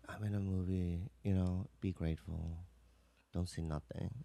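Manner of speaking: low, hushed self-talk; quietly talking himself down, as if thinking; inner voice. Retold or imitated. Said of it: "And in my head, I'm like".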